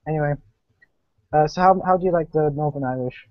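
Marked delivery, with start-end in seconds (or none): none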